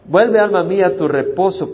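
booming, high proclamation of the verse; proclaimed loud. Acted. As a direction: call it out to the whole church. Proclaims psalm to the soul. (proclamación fuerte y aguda)

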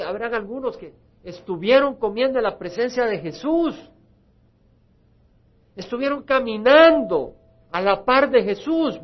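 impassioned altar-call preaching; fiery preaching. Acted. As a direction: preach it at full force to the congregation. Preacher builds to climax.

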